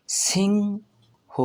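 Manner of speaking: সিংহ high and very slow, ng held long; pronunciation demo. Shown as a demonstration. Teacher joins the letters for learners, সিংহ drawn out. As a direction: hold the ng in সিংহ, then a separate ho. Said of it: "এর সম্পূর্ণ উচ্চারণ হবে".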